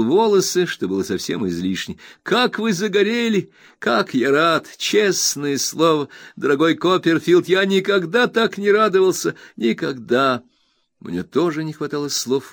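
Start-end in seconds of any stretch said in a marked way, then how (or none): 2.2-10.4 high, bright-eyed friend overjoyed; eager Traddles. Acted. Traddles reassures his old friend Copperfield, eager high voice. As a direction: gush with happiness at the reunion.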